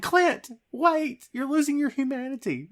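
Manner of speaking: hammed-up Natasha pleading with Clint. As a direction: beg him to stop, playfully. Imagined plea Natasha never made.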